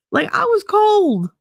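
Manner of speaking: high, drawn-out protest; emphatic protest. Real, not acted. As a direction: stretch it out in a high, whiny insistence. Protesting how cold she was.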